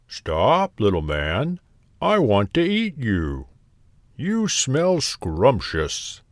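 storybook cow, drawing out the last words; mooing cow. A narrator's reading. Cow calls to the gingerbread man, slowing mooing voice. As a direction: moo the words slowly toward the end. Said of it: "mooed the cow".

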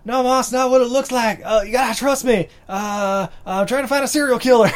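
high, loud excuses to Mom; hammed-up frantic explaining; flustered son. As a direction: protest like a grown son caught out. Acted cop excusing himself to mom.

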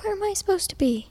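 high-pitched retold plea; confused player. Retold confused reply to yelling coach.

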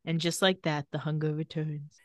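low, softly intoned mock melodrama; lightly hammed-up brooding, voice dropped; drama queen. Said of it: "like the drama queen he is".

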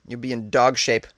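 normal talking voice

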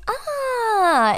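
long, high-pitched blissful "aah"; drawn-out "aah" of food bliss; delighted "ah". Retold or imitated. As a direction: hold a high, mock-heavenly sigh of delight. Acts out delighted reaction to eggplant.

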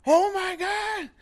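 very high, drawn-out squeal; mock a gushing fan's high squeal, slowly; excited girls. Group of girls spotting the girlfriend, high mock fangirl squeal. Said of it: "this group of girls just right away".

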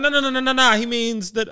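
high, loud rush of objection; excited retold protest; mock urgent correction. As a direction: cut in loudly, pitched right up, as if correcting someone. Acting out a hurried correction.

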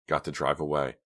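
ordinary delivery, nothing marked

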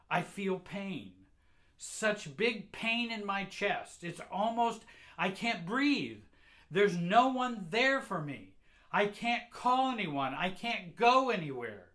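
high, loud, desperate outcry; anguished panic. Acted. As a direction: cry out as if nobody will come. Child in panic, pain, unable to breathe.

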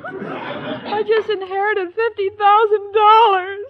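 slow, laughing and weeping at once; laugh-crying. Acted. Irma tells the Professor her fortune, laughing and crying. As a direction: blurt the news through happy tears. Tearful announcement of windfall.

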